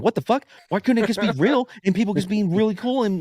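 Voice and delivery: high-pitched exasperated retort; mock-offended, pitched well up; indignant protest. Retells own protesting reaction.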